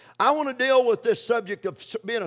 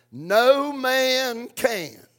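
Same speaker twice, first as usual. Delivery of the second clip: drawn out very slowly, each word weighted; slow emphatic recitation; 2 semitones higher, much slower than usual. Shown as a demonstration. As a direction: spell it out slowly for the congregation to repeat. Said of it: "I want to say it again".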